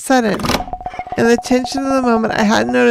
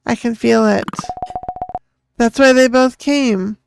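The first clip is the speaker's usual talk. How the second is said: ordinary delivery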